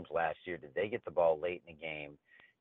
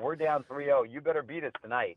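higher, cocky taunting ballplayer; cocky player taunt; 8 semitones higher than usual. Retold or imitated. Retelling the player taunting the Yankees, in a cocky higher voice. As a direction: taunt them like the cocky Red Sox player. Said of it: "He's sitting there going".

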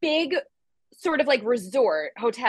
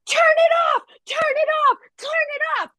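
screaming; much faster than usual. Retold or imitated. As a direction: scream it at the light in panic. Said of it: "And now I'm screaming".

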